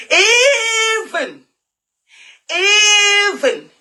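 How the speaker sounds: high, cracking sob on the words; real tears choking the words; voice breaking into crying. Real, not acted. Breaking down mid-appeal.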